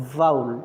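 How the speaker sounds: vowel said as "vaul", low and falling; vowel said deep and clipped; correct pronunciation. Shown as a demonstration. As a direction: model vowel for the class. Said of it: "this is the right pronunciation".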